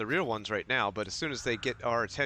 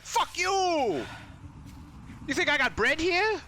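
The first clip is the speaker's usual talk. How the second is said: shelf voice; 13 semitones higher, slower than usual. Retold or imitated. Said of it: "the shelf was like".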